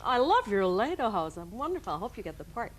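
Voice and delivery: syrupy imitation of a polite rival; sweet to each other. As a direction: coo it sweetly, drawing the words out, in imitation. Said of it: "they were sweet to each other".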